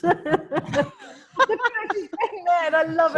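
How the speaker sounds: laughing. Real, not acted. Laughing at Sharon cheering.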